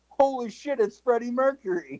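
high, awestruck exclaim; amazed. Retold or imitated. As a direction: gasp it out starstruck. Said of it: "His reaction was".

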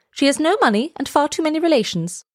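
lightly voiced high bird chatter; a high-pitched twitter of disapproval; twittering swallows. A narrator's reading. Other swallows gossiping about the reed, lightly twittering. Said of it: "twittered the other swallows".

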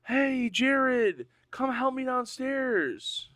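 high, drawn-out household call; calling from downstairs. Acted. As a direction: call up the stairs, slow and high. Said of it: "his wife or mom or sister".

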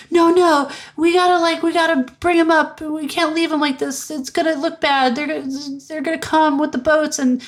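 high, urgent pleading; pleading Vera. Retold or imitated. Vera pleading with Lombard over the body, high and urgent. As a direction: beg him to bring the body up.

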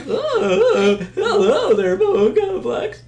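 very high, loud silly squeal; hammed-up falsetto hello, drawn out at the end; mock cracked voice. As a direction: play up a squeaky voice crack through laughter. Mocks own cracked voice, laughing.

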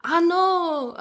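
a hint of Jamaican accent, high. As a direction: mimic a Jamaican TV psychic lightly. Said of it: "a Jamaican accent".

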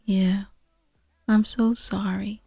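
soft, whispered sorry; sympathetic whisper. Real, not acted. Sympathy to troubled listener.

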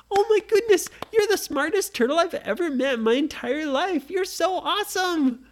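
very high, loud delighted squeal; overjoyed praise for a clever pet; thrilled squeal. Acted. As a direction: burst with delight. Nora thrilled turtle reached key.

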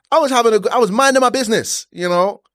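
high, indignant protest; retold frustration. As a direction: act out an aggrieved self-defence. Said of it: "I was so frustrated".

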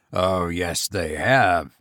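deep, soft, sure goblin voice; goblin contradicting. A narrator's reading. Goblin correcting the frightened sexton, deep and certain. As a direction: overrule him with a low voice.

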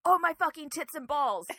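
hammed-up panicked scream; retold scream. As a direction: shout it like a startled man in panic. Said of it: "and he screams".

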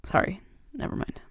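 hushed, breathy take-back; quickly swallowed apology; trailing off. Acted. Catches herself mid-sentence, trails off.

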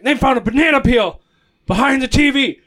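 furious teacher demanding who did it; livid teacher. Retold or imitated. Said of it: "he was just livid".

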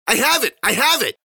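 high, frantic shouting; loud, high-pitched alarm; urgent crying out. A narrator's reading. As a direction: yell to hurry them along. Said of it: "he cried".

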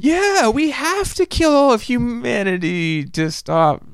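high, slow overacted line; bad acting. Armin's overacted fake plan, slow and high. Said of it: "the acting, like, turns on".

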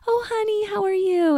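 put-on very high sing-song baby talk; talking to a child. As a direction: coo it up high like greeting a toddler. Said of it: "we see a little child and we're".

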